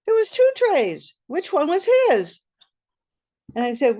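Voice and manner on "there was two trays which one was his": higher, louder, amazed at two trays; stunned by the choice; surprised. Retold or imitated.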